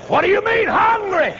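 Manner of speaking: high-pitched indignant outburst; outraged disbelief. Retold or imitated. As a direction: throw the question out high, appalled. Retelling his outraged question.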